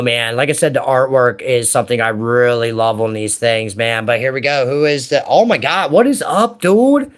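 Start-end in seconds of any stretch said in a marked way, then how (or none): 5.3-7.1 delighted, higher-pitched greeting to a great find; excited surprise. Real, not acted. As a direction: greet the lucky pull, pitch jumping up. Delighted by a card just pulled.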